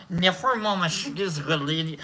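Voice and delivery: ordinary delivery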